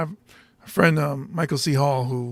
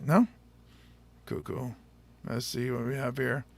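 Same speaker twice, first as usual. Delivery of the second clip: ordinary talk, nothing marked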